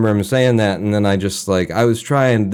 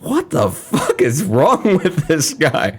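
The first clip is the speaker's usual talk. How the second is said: higher, slow, drawn-out disbelief; bewildered professor; 7 semitones higher, much slower than usual. Retold or imitated. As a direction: imitate the professor's inner bafflement. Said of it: "his internal process must have just been".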